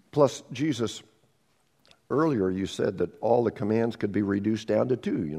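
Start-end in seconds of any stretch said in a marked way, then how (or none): none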